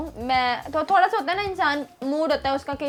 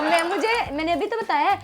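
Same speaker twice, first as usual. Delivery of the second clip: normal talking voice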